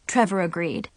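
ordinary delivery, nothing marked

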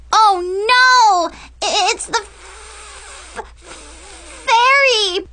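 high, loud, stuttering in horror; terrified stutter. Retold or imitated. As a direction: shriek it out, tripping on words. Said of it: "Brianna stuttered in horror".

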